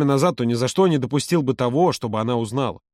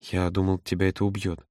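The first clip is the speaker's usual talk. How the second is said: much softer, subdued admission; barely audible; 5 semitones lower, 5 dB quieter than usual. A narrator's reading. Son admits his fear to mother, barely audible. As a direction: say it almost inaudibly. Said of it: "едва слышно произнёс он".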